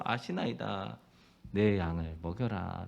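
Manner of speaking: low, soft, slow charge; gentle command. Acted. Jesus gently commissioning Peter, soft and slow. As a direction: tell him tenderly to feed the sheep.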